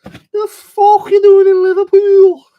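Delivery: loud, slow, high Scouse rant; Scouse accent. Pretend. Answering a viewer's request with a loud, slow Scouse line. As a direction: belt it out like an outraged Liverpool local, in Scouse. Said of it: "Do me some Scouse reselling comment".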